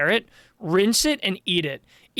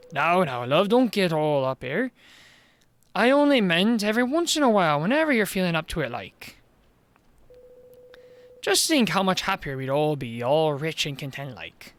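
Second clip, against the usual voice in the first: Welsh mayor voice. Acted.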